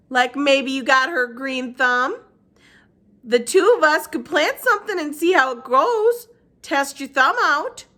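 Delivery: put-on high, slow gentle old woman; Gloria Dump voice. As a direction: speak up high and unhurried.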